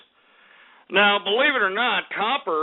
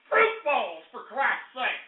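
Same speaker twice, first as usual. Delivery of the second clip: usual speaking voice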